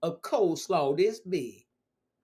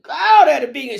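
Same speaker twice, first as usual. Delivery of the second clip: loud, raised exclaim across the room; yelling; 7 semitones higher, 10 dB louder than usual. Retold or imitated. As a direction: call it out from across the table. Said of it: "She yelled at me".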